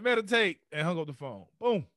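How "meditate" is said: higher, louder one-word command; curt order. Retold or imitated. Monk giving his single instruction before hanging up, louder. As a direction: bark it and hang up.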